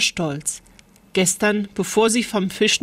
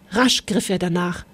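ordinary delivery

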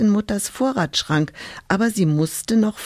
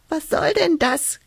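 much higher, annoyed squeak; squeaky hedgehog; 10 semitones higher than usual. Acted. Hedgehog disturbed in his leaves, annoyed high squeak. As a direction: squeak it like a cross little hedgehog. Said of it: "quietschte da ein Igel ärgerlich".

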